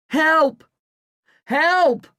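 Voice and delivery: very high, loud cries; frantic high yelling; shout for help. A narrator's reading. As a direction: call out desperately for help. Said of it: "I shout".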